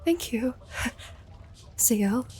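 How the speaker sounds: high, soft little voice; timid thanks with a small giggle; shy thanks. Acted. Dahlia thanking Ziel for the compliment, shy and soft.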